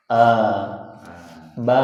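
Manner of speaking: a from the open throat, then ba from the lips; teacher showing a and ba to the class; letter sounds a and ba. Shown as a demonstration. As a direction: model a, then ba, slowly. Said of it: "huruf yang berharokat itu asalnya dari rongga tenggorokan".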